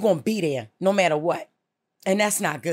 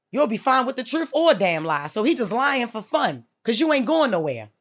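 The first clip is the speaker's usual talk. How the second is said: ordinary talk, nothing marked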